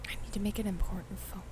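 fast whisper. Acted. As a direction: whisper it like a secret. Whispered mock excuse.

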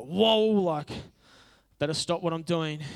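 comic burst of alarm; startled whoa. Retold or imitated. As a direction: recoil from a telling-off look. Acted reaction to a scolding look.

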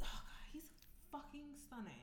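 swooning whisper. Real, not acted. Swooning over Kofi.

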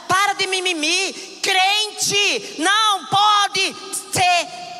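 loud, high, shouted sermon; fiery preaching. Acted. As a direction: preach it out at full force. Preaching at full force.

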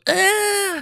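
high, held mock scream; startled scream. Strangers surprised by man at their car, mock high scream. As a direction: imitate the frightened men crying out. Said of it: "throw their hands up, and they go".

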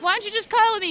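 high, loud pleading. Retold or imitated. Owner begging her corgi to cuddle, high pleading voice. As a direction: beg sweetly for a cuddle. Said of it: "I was like".

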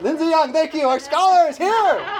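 high, loud, building cheer; excited shout-out. Real, not acted. Preacher thanking a congregant for the answer, exuberant. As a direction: hail them like an arriving champion, laughing at the end.

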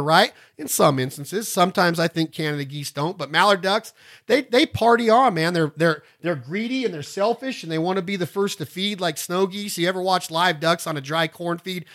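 ordinary delivery

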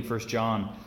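usual speaking voice

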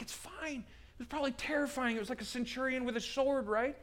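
high, soft, soothing excuse-making; reassuring John Mark. Acted. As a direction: gently offer an excuse in a high, hushed voice. John Mark reassuring Peter, acted.

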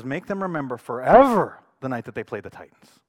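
loud coach's rallying line, imitated; intense. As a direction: drive it home to fire up players. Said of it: "it gets intense".

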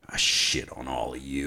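low unvoiced whisper of contempt; whispered, near tears. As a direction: breathe it out in a whisper, close to tears. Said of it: "On the verge of tears".

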